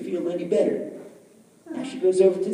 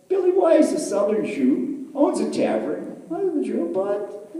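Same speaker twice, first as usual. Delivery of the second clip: ordinary delivery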